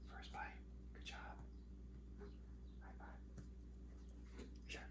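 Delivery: hushed whisper, barely any voice. Real, not acted.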